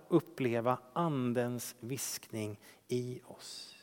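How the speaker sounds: ordinary talk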